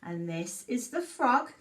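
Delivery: put-on reading a story to children. Reads picture book aloud.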